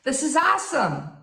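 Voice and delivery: acted amazement, high-pitched wow; impressed listener's wow. French speaker hearing you speak their language, amazed. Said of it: "they're gonna be like".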